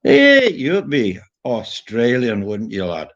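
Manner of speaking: hammed-up Irish accent of an old man; old Irish bloke. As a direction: imitate an old Irish bloke's slow lilt. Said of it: "This old bloke".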